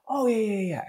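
high, falling "oh, yeah, yeah"; falsely agreeable, pitched right up; mock-nice agreement. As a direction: humour him in a high, sing-out voice. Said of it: "doing it in such a nice way".

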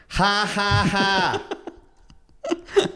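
high nasal ha ha call; nasal bird call. Shown as a demonstration. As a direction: bleat it out like a bird. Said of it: "Typical calls are a nasal".

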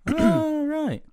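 squeaky high "oh, right"; high mock realisation. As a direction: pitch it way up, mock surprise. Mimicking own realisation reaction.

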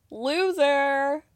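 the word "Loser." sneered in a put-on voice; mocking taunt. Viewer taunting Cody with a sneered "Loser.". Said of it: "such a loser".

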